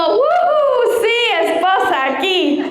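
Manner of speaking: giddy volunteer shouting here; excited cheer. Retold or imitated. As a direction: cheer like you finally get to answer. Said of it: "yo alzo la mano como".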